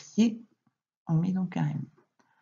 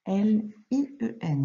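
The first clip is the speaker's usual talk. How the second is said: slow, separated spelling; letter by letter; 3 semitones higher than usual. Shown as a demonstration. Teacher correcting a pupil's dictation, letter by letter. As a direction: spell it out with gaps between letters.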